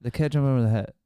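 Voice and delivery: very loud slurred-together repeat; fast, run-on. Shown as a demonstration. Fast talker still not understood, loud and run-on. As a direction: rattle it off as one long word. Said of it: "You talk fast".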